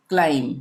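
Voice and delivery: क्लाइम ending on m, no b; low-pitched क्लाइम with the b left off; silent B. Shown as a demonstration. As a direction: close क्लाइम on the m. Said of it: "ये इसका उच्चारण नहीं होगा".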